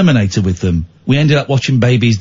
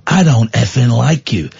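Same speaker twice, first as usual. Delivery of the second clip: lightly voiced tough guy, lower; Jason Statham impression; 5 semitones lower than usual. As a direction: growl it out the side of the mouth. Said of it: "Statham talks out the side of his mouth like that".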